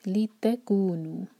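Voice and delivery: लितकूनु slow with ता heavy and pressed; stress on ता. Shown as a demonstration. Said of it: "तो ता पर जोर".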